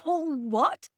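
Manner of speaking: high, startled mock surprise; surprised. She retells hearing her husband's reply, surprised. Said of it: "I was like".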